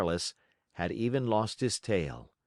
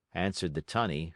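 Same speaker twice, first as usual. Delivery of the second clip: ordinary delivery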